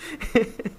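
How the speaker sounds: soft fading chuckle; soft laugh. Real, not acted. Narrator finishing a laugh, soft chuckle. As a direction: let a quiet laugh trail off.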